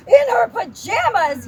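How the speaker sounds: loud, slow disbelief; yelled question. A narrator's reading. Dad yells at Helen about pajamas, loud. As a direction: shout it in alarm. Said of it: "yelled Helen's father".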